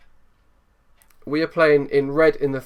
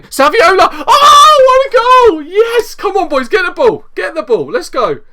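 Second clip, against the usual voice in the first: screaming with joy, much higher and louder; ecstatic goal scream; 13 dB louder than usual. Real, not acted. Gamer screaming as his sub scores.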